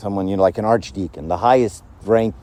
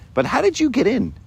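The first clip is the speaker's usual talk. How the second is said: high-pitched, baffled questioning; an angel baffled at who got in; puzzled angel; 12 semitones higher than usual. Acted. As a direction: question the newcomer in higher-pitched disbelief. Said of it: "the angel says, you know".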